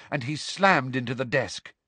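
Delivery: plain reading voice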